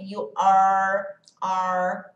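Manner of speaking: "You are, are" with the tongue folded back; "are" held, the R curled up toward the palate. Shown as a demonstration. Teacher repeats "are" to show the curled R. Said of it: "dobrada lá no céu da boca".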